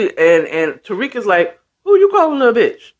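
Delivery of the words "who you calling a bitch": high, quick retort, acted out; mock-offended comeback, pitched up and a bit louder; indignant challenge. Tariq challenging someone over an insult, indignant. Retold character confronts an insult.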